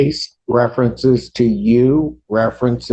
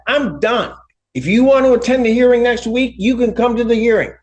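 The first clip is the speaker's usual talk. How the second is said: angry; 10 semitones higher than usual. Real, not acted. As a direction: scold her sharply from the bench. Judge scolds defiant woman.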